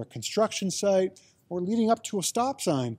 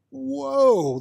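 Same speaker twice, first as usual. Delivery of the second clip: amazed whoa; 6 semitones higher, 5 dB louder than usual. Acted. Tasting salted caramel, amazed.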